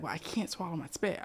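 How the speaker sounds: hushed whisper. Retold or imitated. Whispering child pleads.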